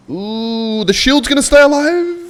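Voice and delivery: very high squeal building to a shout; excited cry. Real, not acted. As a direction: start on a high ooh and get loud with excitement. Excited as shield survives.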